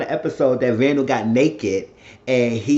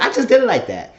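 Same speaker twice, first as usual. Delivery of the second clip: defensive Tanya; 10 semitones higher, 5 dB louder, faster than usual. Retold or imitated.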